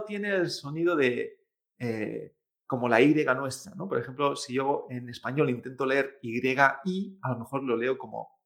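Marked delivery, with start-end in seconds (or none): none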